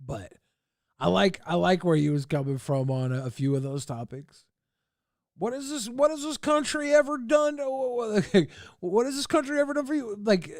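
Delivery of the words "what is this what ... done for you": high-pitched mock paraphrase of an angry rant; mimicking the ranter. Streamer retelling what the ranting man asked, in high-pitched mimicry. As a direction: echo the ranter's question, pitched well up. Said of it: "he's, he's saying, like".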